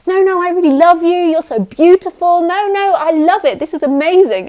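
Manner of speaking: hammed-up fake adoration; fake sweet. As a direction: overdo the loving praise so it rings false. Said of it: "And it's so fake".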